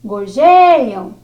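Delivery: "Gorjeiam" called out loud, stress on "jei"; "Gorjeiam" chamado em voz alta, tônica em "jei"; calling the word. Shown as a demonstration. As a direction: call out "Gorjeiam" like naming someone, hitting "jei". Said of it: "você chamar as palavras".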